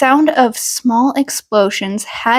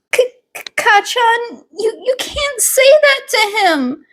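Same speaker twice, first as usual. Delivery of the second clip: put-on much higher, nervous child voice with stutters; a scared little kid pleading with his bully; timid stammering boy; 12 semitones higher than usual.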